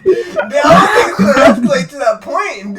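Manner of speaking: high, loud laughing protest; mock protest. Retelling own comeback to Mark, high loud mock protest. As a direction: retell it as a loud, incredulous plea.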